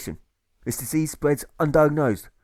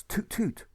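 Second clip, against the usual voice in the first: mock toot; 10 semitones higher, 6 dB quieter than usual. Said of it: "This bagman shouts".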